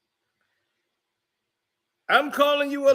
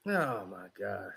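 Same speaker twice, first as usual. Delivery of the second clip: usual speaking voice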